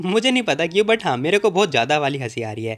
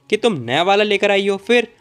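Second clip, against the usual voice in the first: higher, louder, pressing the point home; insistent accusation; 5 semitones higher, 5 dB louder than usual. Retold or imitated. Su confronting the girl about the lotion, insistent and raised. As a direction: repeat it like catching her in a lie. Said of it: "तुमने बोला था ना".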